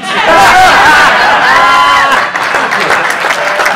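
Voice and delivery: loud burst of laughter; big laugh. Real, not acted. Audience roars at punchline.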